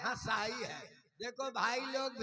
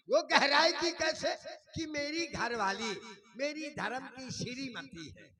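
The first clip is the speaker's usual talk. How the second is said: ordinary delivery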